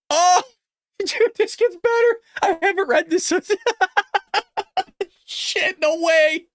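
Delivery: high, cracking up between words; helpless laughter breaking into the words; real laughing disbelief. Real, not acted. Cracks up at the next revelation.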